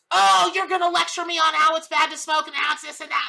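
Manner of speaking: loud, high mock-indignant retort; defensive client. Smoking client accusing the reader of lecturing, loud mock indignation. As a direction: throw the accusation back, affronted.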